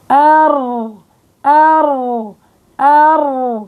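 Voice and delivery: short demo ra with the mid tongue hollowed; demo of the letter ra. Shown as a demonstration. Teacher demonstrating a heavy ra. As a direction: sound out ra, tongue hollowed, throat narrowed. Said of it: "وقت بتقعر وسط اللسان".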